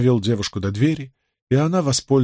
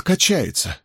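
usual speaking voice

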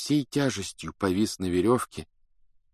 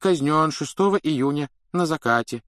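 higher-pitched droning read-out; droning official reading; 7 semitones higher, 4 dB louder than usual. A narrator's reading. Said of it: "монотонно бубнил Фадж".

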